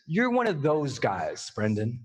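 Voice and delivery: slow, low and weighty; retold verdict, dropping very low on the name; grave verdict. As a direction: deliver it slowly like a solemn mentor.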